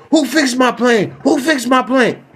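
high, insistent repeated question; demanding owner. Acted. Plane owner demanding who fixed it, high. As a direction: ask it twice, pressing.

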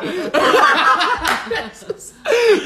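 high, loud burst of laughter; real high-pitched cackling laugh; real laughing fit. Real, not acted. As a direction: crack up at your own story. Cracking up at own story.

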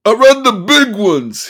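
high, loud, slow shout; sudden shouted outburst. Acted. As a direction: blurt it out loud. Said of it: "he shouted suddenly".

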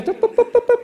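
high boop sound effect; imitating the agent's keyboard beeps; beeping. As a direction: chirp the boops like a computer.

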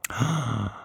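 a sudden, hammed-up gasp of shock; mock gasp. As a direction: gasp as if scandalized.

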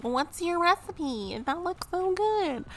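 high, gushing Instagram commenter; gushing fan voice. Retold or imitated. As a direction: coo over the food like an eager follower. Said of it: "People are like".

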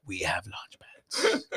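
mocking breathy whisper. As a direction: whisper it into someone's ear. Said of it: "and you're like".

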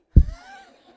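a big high burst of laughter; loud laugh. Real, not acted. Leader laughing after wishing Janet happy birthday, loud and high.

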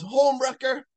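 high-pitched mock yell; high, hammed-up yelled accusation; retold yell. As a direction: call it out high, imitating an angry shooter. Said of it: "shoots her head off and yells".

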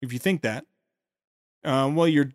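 ordinary talk

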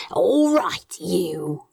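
hushed, breathy menace leaning in; whisper. A narrator's reading. Crowley leaning in to threaten the plant, whispering. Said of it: "leaning in".

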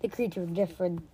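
usual speaking voice

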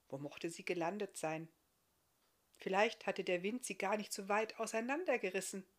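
ordinary delivery, nothing marked

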